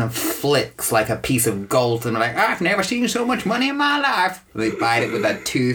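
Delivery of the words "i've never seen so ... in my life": put-on high, amazed old prospector; high-pitched elder's delight; old person. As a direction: marvel like a storybook old-timer. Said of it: "Thank you, old kindly person".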